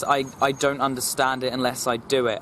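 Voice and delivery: ordinary talk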